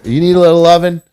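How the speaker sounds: high, loud doggy-talk coo. Real, not acted. Streamer coos to his dog, jealous of the new pup.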